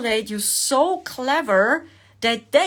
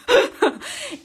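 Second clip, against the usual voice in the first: a burst of high real laughter; bright amused giggle; 14 semitones higher than usual. Real, not acted. Laughing at own mock question.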